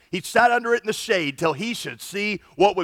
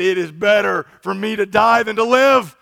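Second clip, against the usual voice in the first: louder, anguished plea to die; despairing Jonah; 2 semitones higher, 7 dB louder than usual. Acted. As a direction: cry it out as if wanting death. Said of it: "he asked that he might die".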